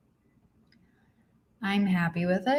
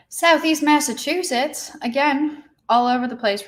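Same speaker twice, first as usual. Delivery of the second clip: excited greeting; 7 semitones higher, 5 dB louder than usual. Real, not acted. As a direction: call out the place name happily. Reading out viewer locations.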